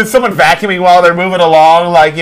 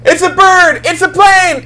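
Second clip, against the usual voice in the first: excited shout; 12 semitones higher, slower than usual. Acted. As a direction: shout it out in wonder. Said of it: "It's [name] reading a story".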